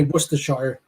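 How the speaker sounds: Worcestershire ending in a full "shire"; American mispronunciation. Retold or imitated. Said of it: "America, they'll say".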